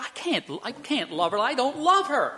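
retold helpless protest, rising in pitch; acted protest. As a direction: imitate a husband's exasperated refusal, climbing high at the end. Acted husband protesting to counselor.

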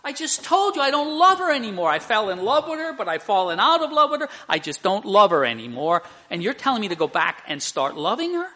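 high, loud retold protest, racing at first; mock incredulous outburst. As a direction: act out a flabbergasted husband protesting. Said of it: "his jaw dropped down to the floor".

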